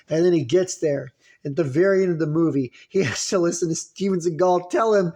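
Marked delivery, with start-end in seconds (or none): none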